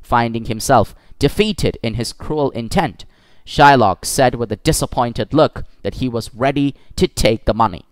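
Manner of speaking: plain reading voice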